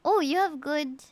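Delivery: usual speaking voice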